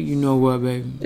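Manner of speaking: low, soft seductive murmur; teasing attempt at a sexy voice; put-on sexy voice. Teasing partner with a put-on low sexy murmur. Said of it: "this is so forced right now".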